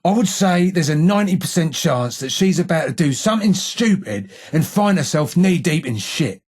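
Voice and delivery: ordinary delivery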